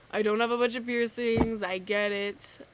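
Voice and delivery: loud mock-defensive; defensive outburst. Real, not acted. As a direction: push back loudly at the viewer.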